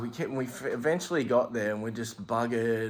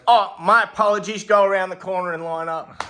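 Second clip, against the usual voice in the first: higher, louder, curt brush-off; mock bouncer; 8 semitones higher, 7 dB louder than usual. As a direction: send them back to the queue. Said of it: "the guy goes".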